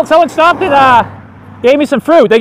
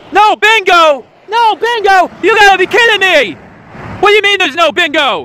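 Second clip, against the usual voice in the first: high, loud, outraged mock whine; over-the-top Joe Montana, yelling high; Joe Montana impression; 8 semitones higher, 4 dB louder than usual. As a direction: ham up a star quarterback robbed of his bingo. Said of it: "my impression of Joseph Montana eating some dates".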